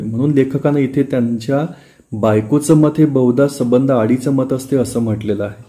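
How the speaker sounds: ordinary talk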